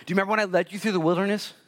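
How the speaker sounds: Jesus pressing Luke to remember. Acted. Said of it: "And he says".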